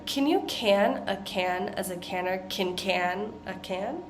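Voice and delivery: "Can you" run tight together, verb "can" reduced to "kin"; verb can linked. Shown as a demonstration. Teacher shows the rhythm of verb "can". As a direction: chunk each verb "can" tightly onto the next word. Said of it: "notice my rhythm whenever I'm using the verb".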